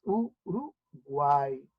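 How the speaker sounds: Uruguay very slow and soft, three beats; syllables spelled out. Shown as a demonstration. Teacher quietly repeating Uruguay split into syllables. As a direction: drag out u-ru-guay gently. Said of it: "se tendrá tres sílabas".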